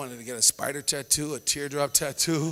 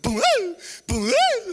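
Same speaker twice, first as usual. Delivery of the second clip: mock toddler crying; 6 dB louder, much slower than usual. Toddler hit on the head by mom, high mock wail. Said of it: "and you would cry".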